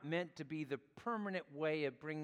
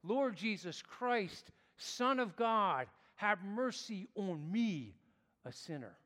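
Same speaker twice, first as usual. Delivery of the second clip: earnest prayer; 4 semitones higher than usual. Acted. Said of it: "it has been a prayer of mine".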